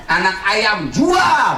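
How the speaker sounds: high shouted single word; emphatic cry. Acted. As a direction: punch it out as a sudden command. Acted urgent decision to sell. (seruan tegas bernada tinggi)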